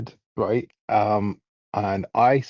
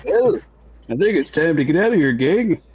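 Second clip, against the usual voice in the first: high, loud, urgent call; loud character call; 7 semitones higher, 6 dB louder than usual. Acted. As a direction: shout to the gang to get out.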